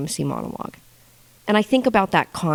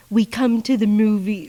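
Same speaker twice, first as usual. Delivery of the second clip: Nicole Kidman quote; 4 semitones higher than usual. Retold or imitated. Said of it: "I quote that shit".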